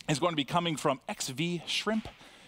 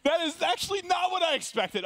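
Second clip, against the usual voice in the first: high-pitched, talking through laughter; laughing so hard the voice jumps up high; amused disbelief; 12 semitones higher than usual. Real, not acted. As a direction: squeeze the words out while laughing. Host laughing at unexpected answer.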